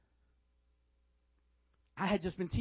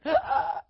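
high mock gasp-scream; short, high yelp like startled kids; imitated frightened scream; 12 semitones higher, 4 dB louder than usual. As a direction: imitate children freaking out. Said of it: "they're all screaming".

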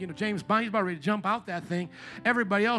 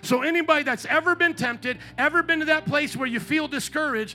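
rising, louder preacher's call; preaching; 4 semitones higher, 5 dB louder than usual. Acted. Pastor urging the congregation, preaching hard. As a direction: build it up like a revival preacher.

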